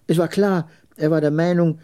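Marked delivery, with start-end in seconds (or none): none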